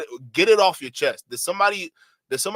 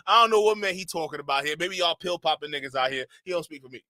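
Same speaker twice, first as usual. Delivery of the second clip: usual speaking voice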